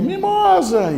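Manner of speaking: very high, loud echo; echoed call. Acted. Valley answers the boy's call, echoing shout. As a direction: throw the name back as an echo. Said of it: "lá responde".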